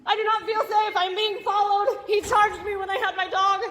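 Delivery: panicked shouting at office staff; scared shouting. Real, not acted. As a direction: shout it out, voice strained with fear. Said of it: "You cannot be screaming like that".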